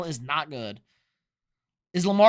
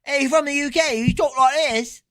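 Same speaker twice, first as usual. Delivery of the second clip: high-pitched put-on British accent; hammed-up UK accent, pitched well up; mock British accent; 8 semitones higher, 4 dB louder than usual. Streamer mocking a viewer from the UK, exaggerated British accent. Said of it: "MA Guru's from the UK".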